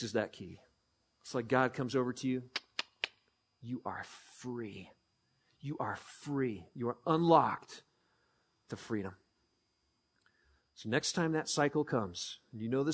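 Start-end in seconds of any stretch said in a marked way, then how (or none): 3.6-9.1 much softer, slow and low; gentle whisper of grace. Acted. God gently telling a struggling person they are free, tender. Voicing God's gentle words.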